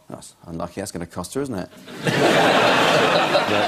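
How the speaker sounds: ordinary delivery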